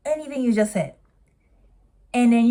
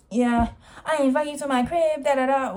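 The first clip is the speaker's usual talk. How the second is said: higher, mocking imitation of her friend; 6 semitones higher than usual. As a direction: parrot her message with a sneer. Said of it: "she's just basically saying".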